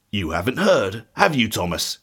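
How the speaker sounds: low, gentle questioning; gentle realisation. A narrator's reading. Peter to tearful Thomas, gently realising.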